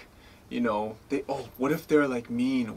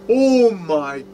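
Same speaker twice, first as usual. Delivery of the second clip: slow, louder, drawn-out gasp of awe; stunned realization; 6 semitones higher, 7 dB louder, much slower than usual. Retold or imitated. Realizing the dream came true, slow awed gasp. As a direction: let the realization hit you. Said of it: "I was like".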